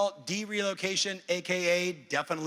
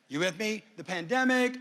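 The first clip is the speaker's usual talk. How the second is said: ordinary delivery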